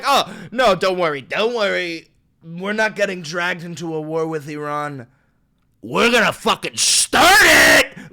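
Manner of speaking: reassure, then blurt out the war; mock Trump. Mocking Trump's denial to viewers, a loud sarcastic yell. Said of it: "so it's like".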